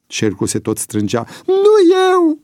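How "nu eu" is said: very high, loud wailing denial; bursting into tears. A narrator's reading. Cornered Boian denying it to the closing circle, tearful wail. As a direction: wail as you break down. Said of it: "izbucni Boian în plâns".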